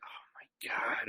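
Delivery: slow, voiceless aside to yourself; dismayed whisper. Retold or imitated. Wrestler recognized at work, to himself, dismayed whisper. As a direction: whisper it under your breath, drawn out. His dread before turning to fans.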